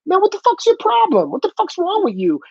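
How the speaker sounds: put-on high, indignant street-kid voice; imitating a kid high and outraged; mock indignant kid. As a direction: squeal it like an outraged punk. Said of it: "they would be like".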